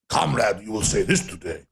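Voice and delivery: put-on very low Putin with a Russian accent; hammed-up deep Russian accent; bad Russian accent. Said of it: "I don't really do a good Russian accent".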